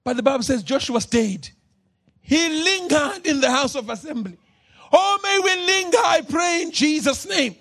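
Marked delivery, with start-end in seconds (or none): 4.9-7.5 fervent prayer. Acted. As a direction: pray it out with full force over the church. Preacher prays at full force.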